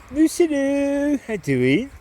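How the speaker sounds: high slow cooing to a pet. Real, not acted. Cooing to the dog.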